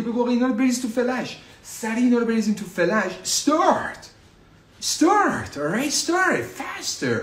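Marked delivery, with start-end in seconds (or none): 3.2-7.2 much higher, rallying start; urgent cheer. Acted. Teacher rallies students to start the course right away. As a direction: pump them up to start now.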